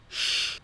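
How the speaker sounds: unvoiced shushing "shh"; one long hushing shh; siseo de silencio. Shown as a demonstration. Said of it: "hacer el sonido de guardar silencio". ("shh" pidiendo silencio)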